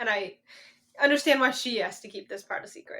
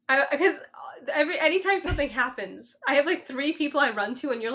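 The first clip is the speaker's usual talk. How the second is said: ordinary talk, nothing marked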